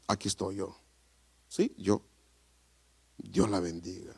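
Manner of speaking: very soft, low retold speech; subdued. Preacher retells offering to pay at the till, hushed low voice. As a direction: say it hushed and low, almost to yourself.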